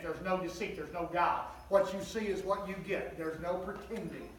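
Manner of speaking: usual speaking voice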